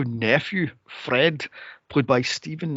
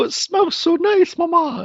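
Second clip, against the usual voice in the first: put-on squeaky little kid voice, far higher; high child voice; 4 dB louder than usual. Little kid tells mama the goose smells nice, in a squeaky high child voice. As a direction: play the little Muppet kid sniffing the goose. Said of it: "he's going".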